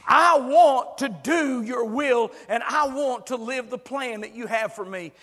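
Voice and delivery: voicing a listener's fervent confession; earnest prayer. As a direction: pour it out to God. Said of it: "come to God and say".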